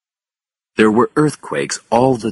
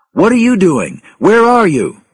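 loud, high, urgent questions; alarmed questioning; 14 semitones higher, 7 dB louder than usual. A narrator's reading. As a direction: demand answers over the radio.

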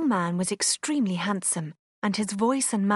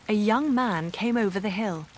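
ordinary delivery

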